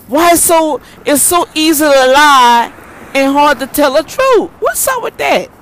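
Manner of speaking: loud and high, thrown out at the listeners; exasperated outburst. Real, not acted. Ranting to her viewers about lying, loud and exasperated. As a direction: let the outrage about lying burst out.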